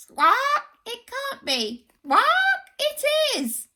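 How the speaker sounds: high, amazed gasps of disbelief; astonished. Acted. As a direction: react in stunned surprise. Crowd astonished at the monster.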